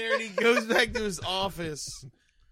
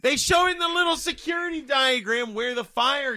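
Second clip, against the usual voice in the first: loud; 7 semitones higher, 6 dB louder than usual. Real, not acted. Insisting during an argument.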